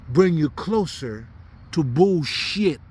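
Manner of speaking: normal talking voice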